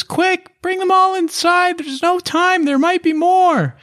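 very high, squeaky little halfling voice; halfling Amelia. Acted. GM voicing the halfling urging the party inside, high and squeaky. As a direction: squeak it out in a tiny urgent voice.

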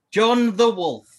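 playful loud. Acted. Wordplay on DeWolf, loud.

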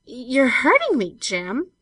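loud, high, slow protest; pained protest. A narrator's reading. Girl in Jem's tight grip, pained protest. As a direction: protest as if gripped too hard.

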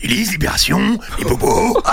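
put-on French singer's voice, losing its tone; altered voice fading into unvoiced sound. Shown as a demonstration. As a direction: do a short mock impression of the singer. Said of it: "il fait [name], il fait [name]".

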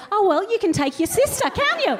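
high, lilting mock mum; breezy mum voice, pushing it on her; mum's sing-song voice. Mum telling older sister to take little one, high sing-song.

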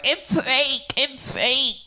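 high-pitched mock yelp of protest; retold panicked cry. Telling friends how they protested to the thief, retold panicked cry. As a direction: squeal it like a panicked victim.

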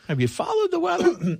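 very high mocking squeak; squeaky falsetto. The repeat mocks the reporter in a squeaky voice.

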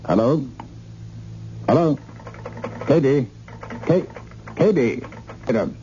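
high-pitched calls into a dead line; urgent calling. Acted. Man cut off from London calls for the operator, urgent. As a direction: call out repeatedly for a reply, pitched high.

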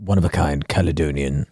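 low, slow, hushed connoisseur; Martin Rice. Acted. Host plays water sommelier presenting a rare bottle, slow solemn reverence. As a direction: savour each phrase with long reverent pauses. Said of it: "Martin Rice, water sommelier".

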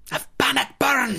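high Scottish Bannockburn, rolled r; Scottish accent. Shown as a demonstration. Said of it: "Thank you".

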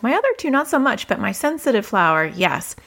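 ordinary talk, nothing marked

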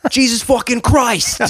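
high, loud put-on New Yorker on the phone; exaggerated loud New York phone quarrel; loud phone arguer. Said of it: "partners sort of having a dispute".

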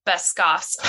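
normal talking voice

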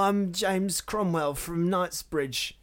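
high, loud, drawn-out mash-up of Cockney and posh British; hammed-up Cockney-meets-posh British, slow and high; Cockney-posh British accent. Co-host mocking Americans' British accents, hammed-up Cockney-posh mix. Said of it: "a weird combination of both".

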